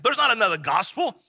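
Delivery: preacher voicing Paul's passionate protest. Shown as a demonstration. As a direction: pound it home with fervour. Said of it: "He's probably getting very passionate".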